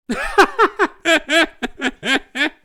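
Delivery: long éclat de rire fort et haut; éclate d'un rire qui monte; long fou rire. Real, not acted. (fou rire sonore)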